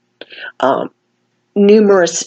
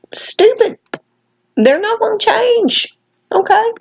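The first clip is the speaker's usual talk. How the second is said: exasperated; 13 semitones higher than usual. Real, not acted. Exasperated rant at sellers.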